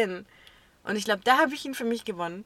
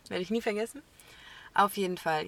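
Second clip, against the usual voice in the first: ordinary delivery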